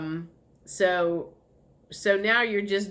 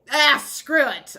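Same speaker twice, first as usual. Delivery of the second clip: louder, slower dismissive groan; fed up; 5 semitones higher, 7 dB louder than usual. Retold or imitated. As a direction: throw it away with a loud sigh. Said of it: "you just, like".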